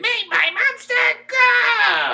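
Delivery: shrill, very high, drawn-out villain screech; Rita Repulsa voice. Retold or imitated. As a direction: screech it like a campy witch queen. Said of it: "Rita does her Rita thing".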